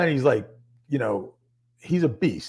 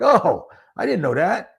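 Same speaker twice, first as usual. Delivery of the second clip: sheepish surprise; 6 semitones higher, 4 dB louder than usual. Retold or imitated. Sheepish realization.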